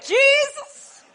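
high, loud cry for help; crying out to Jesus. Retold or imitated. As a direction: call on Jesus with full voice.

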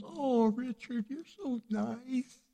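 slow, high, softened mock swoon; melting, touched. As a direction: play the wife melting with gratitude, slowly. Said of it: "I saw her melting in the seat".

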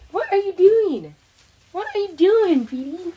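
high, loud doggy talk. Real, not acted. Talking to her dog Petey in high baby talk.

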